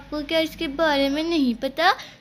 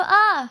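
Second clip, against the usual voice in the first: startled cry; 4 semitones higher, 7 dB louder than usual. Acted. Toys drop as kids miss.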